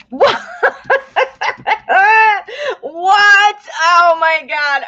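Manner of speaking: high, loud cry of surprise breaking into a laugh; shocked disbelief. Real, not acted. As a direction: yelp at the cards, then laugh it off. Shock at the drawn tarot cards.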